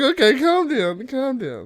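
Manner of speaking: high mock placating, volume easing off; retold placating. Teller to his worked-up cousin, mock placating. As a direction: back off and soothe him, playfully. Retelling soothing an angry cousin.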